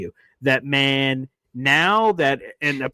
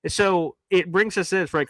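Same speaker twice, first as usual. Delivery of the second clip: ordinary delivery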